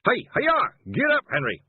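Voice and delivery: a loud, high shout to the horse; a carter shouting at his horse; yelled. Acted. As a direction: yell the horse into a gallop. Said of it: "took a deep breath and yelled".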